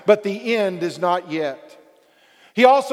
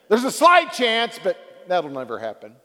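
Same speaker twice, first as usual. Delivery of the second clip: casual dismissive never; 5 semitones lower than usual. Retold or imitated.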